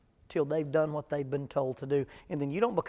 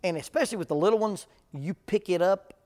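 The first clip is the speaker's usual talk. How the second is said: usual speaking voice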